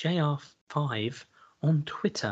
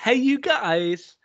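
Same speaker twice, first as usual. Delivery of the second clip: sing-song greeting; 9 semitones higher, 3 dB louder, much slower than usual. Acted.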